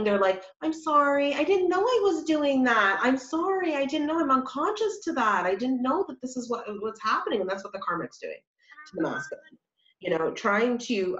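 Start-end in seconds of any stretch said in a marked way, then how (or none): 0.6-7.4 high, pleading, over-apologetic rush of sorries; pleading apology. Retold or imitated. Karmic apologizing to the masculine, high pleading apology. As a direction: beg forgiveness like someone caught out. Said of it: "They keep saying".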